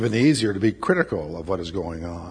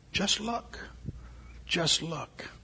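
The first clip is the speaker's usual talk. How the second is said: soft urging; 6 semitones higher, 10 dB quieter, much slower than usual. Real, not acted. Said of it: "preacher softly urges".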